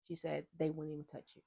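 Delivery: ordinary talk, nothing marked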